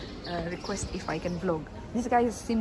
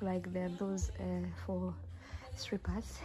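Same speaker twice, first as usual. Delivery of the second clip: whispered aside, much softer; hushed; 3 semitones lower, 12 dB quieter, slower than usual. Real, not acted. Vlogger to viewers about the lingerie on sale, hushed. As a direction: drop to a discreet whisper. Delicate remark inside a shop.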